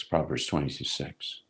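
low soft repeat of Proverbs twenty-two six; murmured reference repeated to himself; quiet echo. Shown as a demonstration.

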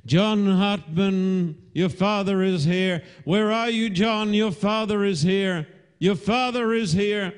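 high, insistent calling out; ringing high cry, sustained to the end; taking up the cry. Retold or imitated. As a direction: call urgently for a missing man. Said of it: "took up the cry".

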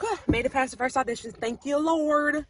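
relieved thanks. Retold or imitated. Acts out her relief.

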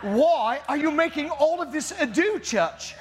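put-on high, theatrical old-English God; King James voice. God chides the church over the prophets, hammed-up King James voice. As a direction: declaim it like a mock-Shakespearean deity. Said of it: "in his King James voice going".

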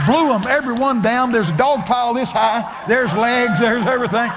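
loud, high, rapid-fire storytelling; excited shouting. Real, not acted. Preacher tells congregation of the pile-up, excited shouting. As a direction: shout the chaos out. Excited retelling of crowd falling.

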